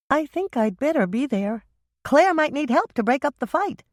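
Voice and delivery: bright teasing younger sister; younger sister Michelle. A narrator's reading. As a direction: younger sister joking about a fight. Michelle voiced higher.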